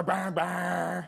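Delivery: put-on half-remembered Southern kid from a Vine; mock Southern twang on nonsense syllables. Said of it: "that really southern twang".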